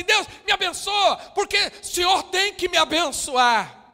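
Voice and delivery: high, loud shouted demand at God. Retold or imitated. Mocking people who order God around, in a loud shout. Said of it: "Tem gente que acha que ele fala assim".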